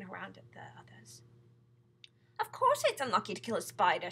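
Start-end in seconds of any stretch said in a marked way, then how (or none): 2.4-4.1 loud, forceful outburst; shouting. A narrator's reading. Centipede answers James's question, shouting. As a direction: shout it as if obvious. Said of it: "shouted the centipede".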